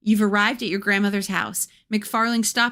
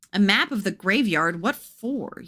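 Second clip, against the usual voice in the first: usual speaking voice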